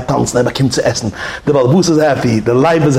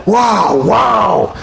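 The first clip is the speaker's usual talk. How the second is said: lion roar; 7 semitones higher than usual. Retold or imitated. Lion roars in cage.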